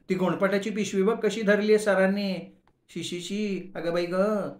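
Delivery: wrinkle your nose at the sack; disgusted college girl. Acted. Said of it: "दुसरीनं शहारे काढले".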